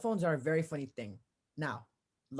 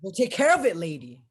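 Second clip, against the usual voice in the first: loud, higher, fed-up snap at the caller; a policeman losing patience with a fussy caller, loud and sharp; exasperated outburst; 4 semitones higher, 10 dB louder than usual. Acted. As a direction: bark it to shut her up. Impatient cop cuts off caller.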